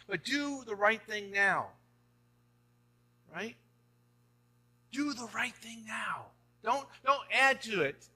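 rising pastoral urgency; impassioned preaching. Real, not acted. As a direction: plead with them to do right now. Preaching climax, urging congregation.

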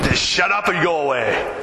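mock-angry dismissal; retold anger. Dad to his laughing daughter, retold angry dismissal. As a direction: send the teasing kid off, lightly acted. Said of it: "I got angry".

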